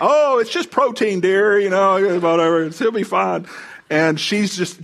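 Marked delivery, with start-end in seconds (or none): 0.0-3.5 teasing nonchalance. Retold or imitated. Teasing wife about the cockroach.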